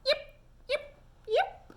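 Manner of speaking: a shy, gentle little fox yip; soft fox yips. A narrator's reading. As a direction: fox thanking the duck softly. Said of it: "a valentine right back".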